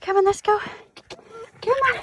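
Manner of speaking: high, encouraging call to a dog. Real, not acted. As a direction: urge your dog along in a high-pitched voice. Calling the dog onwards.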